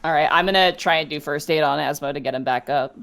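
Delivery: usual speaking voice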